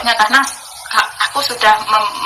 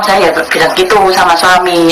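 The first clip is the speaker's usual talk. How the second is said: ordinary talk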